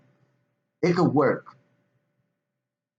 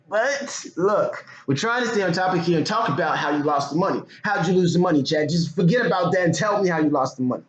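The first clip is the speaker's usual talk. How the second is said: calling out; 8 semitones higher, 4 dB louder than usual. Real, not acted. Calling out Chad.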